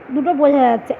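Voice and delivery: normal talking voice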